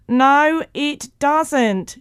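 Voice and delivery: high, loud, defiant shout. A narrator's reading. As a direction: yell the denial over the noise. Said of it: "shouted Mo".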